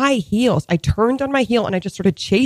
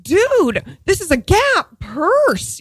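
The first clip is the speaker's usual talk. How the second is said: exasperated; 10 semitones higher, much slower than usual. Retold or imitated. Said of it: "I was just like".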